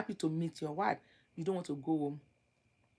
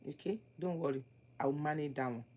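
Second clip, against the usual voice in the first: soft, gentle promise, quieter; calm reassuring; 3 semitones lower, 7 dB quieter, faster than usual. Shown as a demonstration. Fiancée calmly reassuring her man, soft and gentle. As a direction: reassure him tenderly. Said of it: "the way you calmly explain to him".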